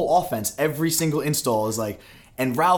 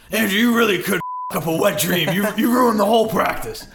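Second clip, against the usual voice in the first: high, raised berating coach voice; mock furious coach; 6 semitones higher, 3 dB louder than usual. Coach ripping him after ruined practice, mock fury. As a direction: chew him out like the old coach.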